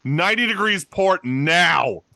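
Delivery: loud, high, drawn-out shouted order; full-force intimidating yell; top-of-the-lungs yell. Acted. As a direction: bellow it like a sailor taking command. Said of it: "yell, like, at the top of his lungs".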